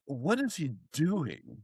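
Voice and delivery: slow, hushed imitation of scandalized neighbours; mock disapproval. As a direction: imitate a prim neighbour, slowly and softly. Disapproving posh neighbours voiced.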